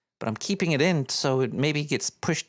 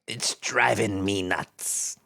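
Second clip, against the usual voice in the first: lower, slow, mock-pirate mutter of the punchline; low slow pirate echo to himself; 8 semitones lower, much slower than usual. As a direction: savour the joke in a low slow growl.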